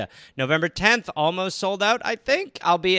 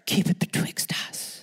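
voiceless conspiratorial whisper; 4 dB quieter than usual. Acted. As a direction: hiss it as a secret. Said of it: "Jemmy the Whisperer".

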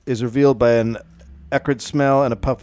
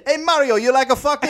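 put-on Italian accent; 12 semitones higher than usual. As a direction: ham up a cartoon Italian. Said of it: "went to Italian".

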